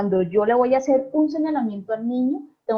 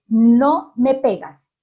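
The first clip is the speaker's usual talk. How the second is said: slow, louder, firm "No me pegas"; firm clear command; 6 dB louder, much slower than usual. Shown as a demonstration. Parent stopping a toddler who hits, slow firm command. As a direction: set the limit, every word weighted. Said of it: "una instrucción clara y precisa".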